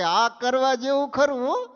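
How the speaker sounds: excited horse. Acted. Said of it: "એ કે".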